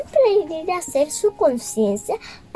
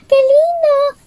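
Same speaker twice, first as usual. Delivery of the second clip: delighted cry; 12 semitones higher, 6 dB louder than usual. Acted. Pinocho delighted at puppets.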